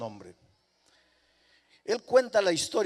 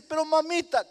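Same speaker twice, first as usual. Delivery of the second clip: crying plea; 12 semitones higher, 8 dB louder than usual. Acted.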